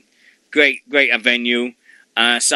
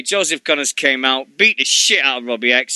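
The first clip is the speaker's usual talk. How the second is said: usual speaking voice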